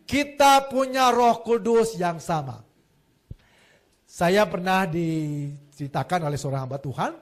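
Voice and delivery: normal talking voice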